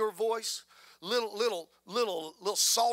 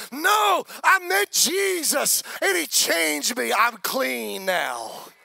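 joyful testimony; 3 semitones higher, 8 dB louder, much slower than usual. Acted. Saved sinner's reply.

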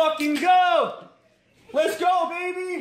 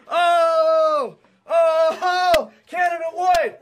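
usual speaking voice

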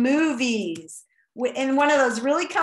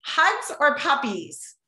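ordinary delivery

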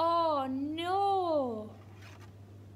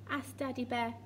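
usual speaking voice

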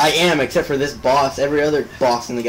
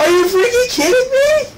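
shocked yell; 7 dB louder than usual. Real, not acted. Game failure, disbelief.

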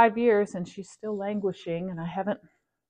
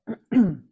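throat clearing; 6 dB louder than usual. Real, not acted.

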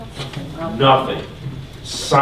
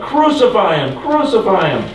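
raised pitch, mild mock shout; higher-pitched retold cry of a mob; retold crowd shout; 5 semitones higher than usual. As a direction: call it out like a crowd, without full volume. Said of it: "they shouted".

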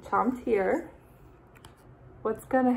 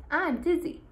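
dizzy character voice; 8 semitones higher than usual. Acted. Character line voiced in story.

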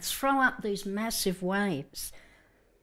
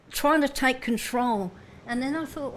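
ordinary talk

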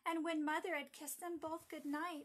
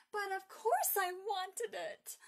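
put-on loud, high, drawn-out indignant child; getting angrier; 6 semitones higher, 9 dB louder, slower than usual. A narrator's reading. Margaret answers mother, getting more angry, loud protest. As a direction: cry it out slowly in childish outrage. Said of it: "cried Margaret, getting more angry".

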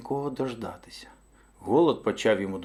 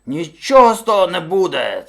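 loud, raised-pitch bellow; booming angry refusal; bear shouting refusal; 5 semitones higher, 9 dB louder than usual. A narrator's reading. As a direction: forbid it as a domineering bear. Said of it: "крикнув він".

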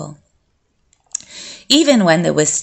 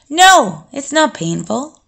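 higher, emphatic no, then easing off; assured denial; 7 semitones higher than usual. A narrator's reading. Vampire answering the boy's question about pain, assured. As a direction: firmly answer the worry.

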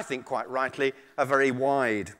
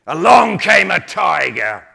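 loud, drawn-out announcement; story narration; 3 semitones higher, 11 dB louder, slower than usual. A narrator's reading. Storyteller introducing a tiger to the audience, dramatic narration. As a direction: announce the entrance loudly and slowly. Storyteller reads the tale aloud.

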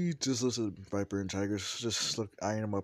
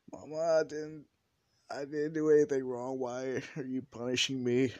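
higher, louder protest to Mama; whining son; 5 semitones higher, 6 dB louder than usual. Acted. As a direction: plead like a wronged kid, pitched up. Son protests to mother in story.

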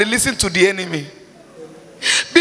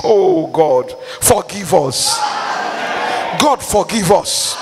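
pleading prayer; 5 semitones lower, slower than usual. Real, not acted. Prayer cry.

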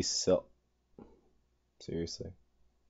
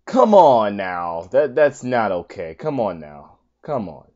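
ordinary talk, nothing marked

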